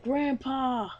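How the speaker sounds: high, drawn-out cry; acted shocked call. Hero finding the wounded master, shocked call.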